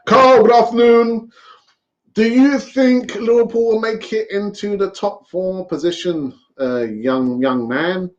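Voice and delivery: put-on a gentle stab at Clough's Midlands accent; Brian Clough impression. Greeting a caller in a mild Brian Clough accent. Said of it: "That's my Brian Clough accent there".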